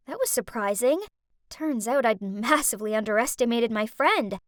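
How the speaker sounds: usual speaking voice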